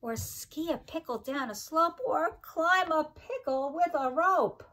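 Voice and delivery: sing-song rhyming read-aloud, each line bouncing; reading to children. Acted. Teacher reading a pickle rhyme book to her class, sing-song. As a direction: bounce the rhymes for little listeners.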